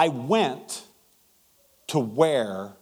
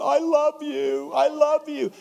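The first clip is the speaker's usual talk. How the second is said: slow, high, wondering "I love you"; tender exchange; 13 semitones higher, 4 dB louder, slower than usual. Retold or imitated. Preacher and the stranger trading words, slow, high and tender. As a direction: savour each word, a little amazed.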